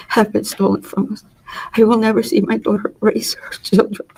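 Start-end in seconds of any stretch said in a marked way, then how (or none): 1.8-4.1 breath-only whisper, voice giving way; barely voiced, grief choking the words; a broken whisper through tears. Real, not acted. Mother breaking down in court.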